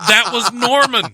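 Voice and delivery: high, laughing recall. Real, not acted. Host recalling the City Slickers cow, laughing high. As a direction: blurt it out mid-laugh.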